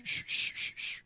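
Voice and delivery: unvoiced shh like a washing machine; whooshing murmur. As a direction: hiss out the murmur. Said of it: "feel that shh".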